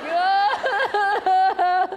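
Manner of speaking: loud, high anguished wail; wailing cry. Retold or imitated. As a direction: let out a big mock sob-wail, going for it. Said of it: "I am going for it".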